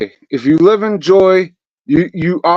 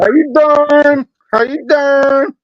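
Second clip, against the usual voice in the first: put-on slow, high New York greeting in a New York accent; hammed-up New York accent; 7 semitones higher, slower than usual. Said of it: "That's New York".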